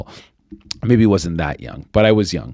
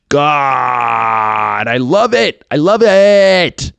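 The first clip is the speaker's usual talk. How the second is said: high, loud, drawn-out rapture; ecstatic gushing; 6 semitones higher, 5 dB louder, much slower than usual. Real, not acted. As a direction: belt out how much you adore it. Gushing over the music he likes.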